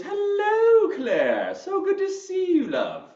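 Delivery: high, loud, gushing British greeting; hammed-up British accent, swooping down from a high pitch. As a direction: play it very British and delighted. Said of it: "do a little bit of a British accent".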